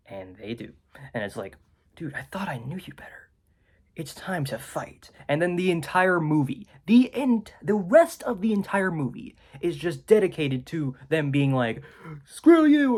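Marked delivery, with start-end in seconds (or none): 1.9-5.1 mock whisper. Mock showdown line to frat. 12.2-13.0 high, loud mock yell. Frat boys' retold insult.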